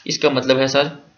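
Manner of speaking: ordinary talk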